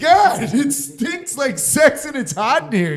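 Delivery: laughing disgust. Retold or imitated. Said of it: "and we're like".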